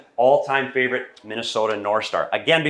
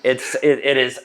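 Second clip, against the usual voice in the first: ordinary talk, nothing marked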